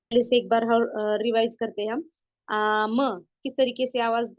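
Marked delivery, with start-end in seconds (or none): 2.5-3.2 long held aa in आम, then m; stretched alif madd. Shown as a demonstration. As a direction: draw out the opening vowel of आम. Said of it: "अलिफ की हम आवाज को खींच रहे हैं".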